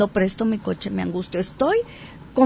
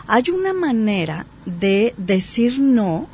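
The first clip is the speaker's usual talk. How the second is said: normal talking voice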